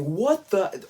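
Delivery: high, loud incredulous cry; shocked outburst. Retold or imitated. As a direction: blurt it out, unable to believe it. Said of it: "I'm like".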